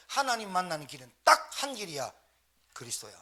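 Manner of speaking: loud, high-pitched impassioned cry; impassioned realisation. Retold or imitated. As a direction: cry out a retold confession at full force. Acting out his intense moment of realisation.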